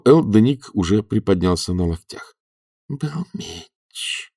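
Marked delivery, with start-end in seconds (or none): 2.6-4.3 very slow, hushed voice; faint, drawn-out whisper. A narrator's reading. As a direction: whisper it weakly, dragging the words out. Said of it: "прошептал он".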